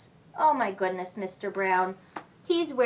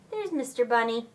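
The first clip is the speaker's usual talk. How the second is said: usual speaking voice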